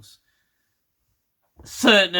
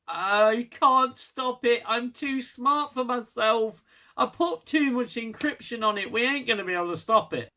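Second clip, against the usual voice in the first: whiny Tarka; 7 semitones higher than usual. Retold or imitated. Tarka telling Book he can't, higher mock whine. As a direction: whine hopelessly about the encryption. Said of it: "And Tarka's like".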